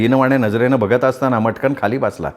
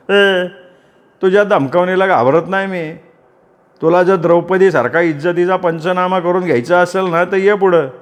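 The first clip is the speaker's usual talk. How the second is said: defiant challenge; 5 semitones higher than usual. Acted. Sanjay challenges Vaishu.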